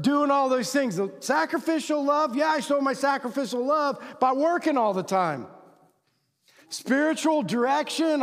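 high, insistent self-justifying declaration; mock proud insistence, pushing each point; defiant provider. Man claiming work shows his love, defiant. Acted defensive provider husband speech.

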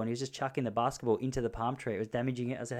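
ordinary delivery

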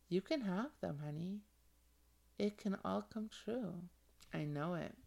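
hushed, dreamy visualization guide; guided visualization. Acted. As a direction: lead them gently into their dream future.

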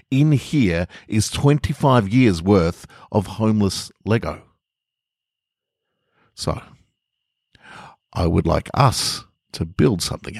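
gentle storyteller reading; measured story reading voice; story narration. A narrator's reading. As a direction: read it like a bedtime tale to listeners. Reading a short story aloud.

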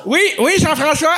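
high, loud goofy eager voice; [name] in fake teeth. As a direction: play the goofy eager contestant. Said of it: "Tu sais, comme".

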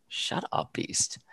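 under-the-breath shut up, Beast; whispered retort. Retold or imitated. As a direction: hiss it at him without voice.

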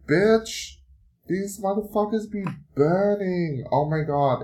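high-pitched comic alarm; sudden realization. Real, not acted. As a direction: it dawns on you all at once. Realizing her eyes burn.

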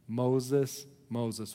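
soft, low call, lightly acted; God calling from the bush. God calls Moses from the burning bush, soft and low. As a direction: call the name gently, low and hushed. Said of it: "he hears this bush say his name".

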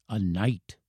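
soft, grave reveal; revealing old professor. A narrator's reading. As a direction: confide it quietly as an old man. Professor reveals his secret identity.

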